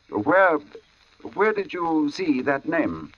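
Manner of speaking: pressing him, half afraid of the answer; startled question. Acted. Narrator startled by his own name.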